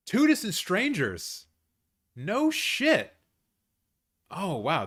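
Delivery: high, loud, slow surprised cry; stunned disbelief. Real, not acted. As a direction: gasp it out in real surprise. Surprised by the winner.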